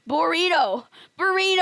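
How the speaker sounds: loud, high silly yell; shouting. Pretend. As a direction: bellow it out, over the top.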